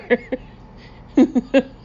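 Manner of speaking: real laughter bursting out; helpless laughter. Real, not acted. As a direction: lose it laughing. Narrator breaks up laughing.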